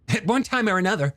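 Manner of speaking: quick, high, eager character voice; chatty younger brother. Acted. Young man recalling his boyhood crush to her, quick high voice.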